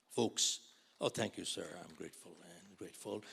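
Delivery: ordinary talk, nothing marked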